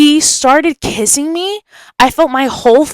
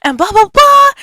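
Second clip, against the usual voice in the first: high-pitched mock-scolding mom voice; retold yell in a high voice; imitating mom yelling; 12 semitones higher than usual. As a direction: put on an irate mom, pitched way up. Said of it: "She started yelling".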